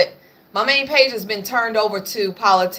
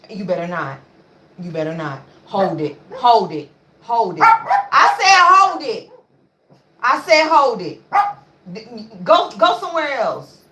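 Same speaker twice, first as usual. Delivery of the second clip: firm command; 3 dB louder than usual. Real, not acted. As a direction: make the dog obey, rising to a shout. Commanding the dog.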